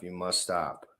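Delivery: usual speaking voice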